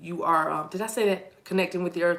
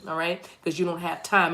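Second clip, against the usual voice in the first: usual speaking voice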